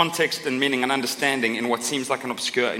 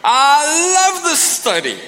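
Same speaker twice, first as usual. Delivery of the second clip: gushing fan; 13 semitones higher, 6 dB louder, much slower than usual. Retold or imitated. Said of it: "one of the things we heard ... is like".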